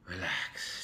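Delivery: normal talking voice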